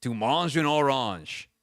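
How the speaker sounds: slow Duolingo French "Tu manges une orange."; careful French. Shown as a demonstration. Showing off Duolingo French to cohost, slow careful reading. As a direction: spell out "Tu manges une orange." slowly like a lesson. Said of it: "I can say".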